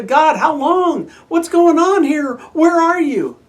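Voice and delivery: high, loud, slow pleading cry to God; anguished crying out. Acted. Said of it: "starts out with the crying".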